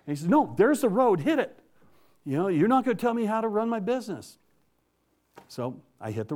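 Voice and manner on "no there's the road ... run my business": high-pitched, emphatic refusal; acted stubborn dismissal. Boss refusing the trucker's plea, high mock-angry dismissal. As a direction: cut off the pleading with a raised voice.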